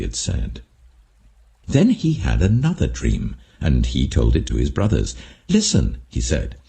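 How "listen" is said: high, bright call for attention; eager call. A narrator's reading. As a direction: call them to listen, pitched up. Joseph calling brothers.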